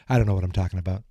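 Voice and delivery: normal talking voice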